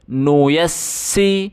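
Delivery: ordinary delivery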